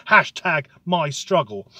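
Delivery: ordinary talk, nothing marked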